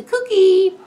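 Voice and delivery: high, loud squawky parrot. A narrator's reading. Parrot speaks in the story.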